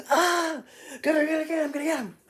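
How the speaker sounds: shrill, high mob screaming; a panicked high yell egging on a mob; high frantic mob yell. Acted. Angry mob cries.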